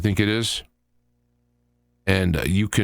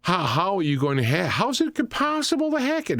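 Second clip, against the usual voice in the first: high, incredulous mock voice climbing far up; mocking Obama laughing; 9 semitones higher than usual. Speaker imitating Obama mocking Trump, high and incredulous. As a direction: scoff at the idea as if it's absurd. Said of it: "And he's like".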